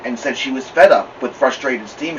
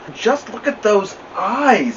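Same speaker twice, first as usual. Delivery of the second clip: cooing; 8 semitones higher, slower than usual. A narrator's reading. Neighbor cooing over Ramona.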